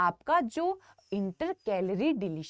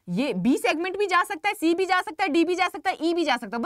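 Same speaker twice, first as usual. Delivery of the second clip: emphatic listing; 7 semitones higher, 6 dB louder than usual. Real, not acted. Teacher stressing each option.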